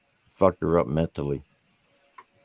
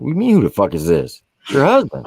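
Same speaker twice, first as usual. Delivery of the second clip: louder, higher, rising into exasperation; indignant retort; 10 semitones higher, 5 dB louder than usual. Retold or imitated. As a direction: throw the question back at her, incredulous. Husband retold, indignant at wife.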